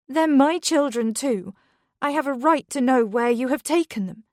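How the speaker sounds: put-on mother demands where her children were taken, hurt and insistent. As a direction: stand up for your right to your kids. Beth insisting on her rights.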